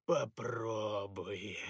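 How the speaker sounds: slow, low gurgling spirit. Acted. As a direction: gurgle it out menacingly. Plague spirit speaks.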